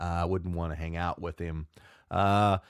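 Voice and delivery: ordinary talk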